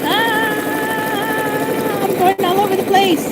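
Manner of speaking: loud, high cry of dismay; alarmed yelp. Real, not acted. Quilter to herself as stitching veers off, alarmed.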